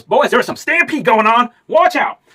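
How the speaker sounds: very high, loud, slow warning yell; alarmed cowboy shout. A narrator's reading. Cowboy warning the boys of a stampede, loud high yell.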